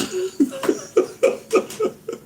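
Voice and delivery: long high wheezing laugh; laughing hard. Real, not acted. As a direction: crack up helplessly at the story. Laughing at own crying story.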